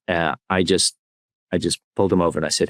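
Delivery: ordinary talk, nothing marked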